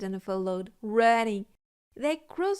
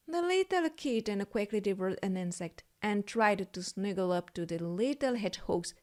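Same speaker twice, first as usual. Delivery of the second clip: ordinary delivery, nothing marked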